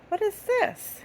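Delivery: high, sing-song quiz voice; playful high question. Acted. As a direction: tease the kids with a guess. High teasing question to kids.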